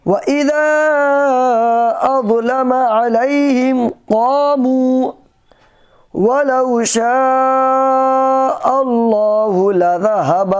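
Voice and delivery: melodic tajweed chant; Quran recitation. Acted. Recites verse.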